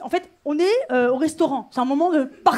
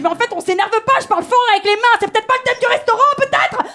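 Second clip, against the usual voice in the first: heated protest; 6 semitones higher, 3 dB louder, faster than usual. Retold or imitated. Dispute au restaurant qui monte.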